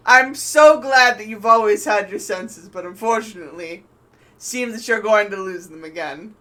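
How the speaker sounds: loud, projected villain voice; theatrical threat called across the water; villain's retort. Acted. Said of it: "he's like".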